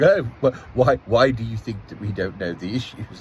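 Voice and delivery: mildly mocking, patronising retort. Office worker answering a local complaint, patronising. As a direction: imitate a condescending official's stammered question. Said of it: "very patronising response".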